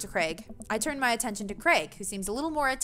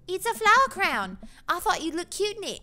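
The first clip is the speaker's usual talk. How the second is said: put-on little girl; 9 semitones higher, 4 dB louder than usual. Little girl offers flower crown.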